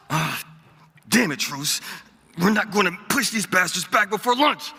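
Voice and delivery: ordinary delivery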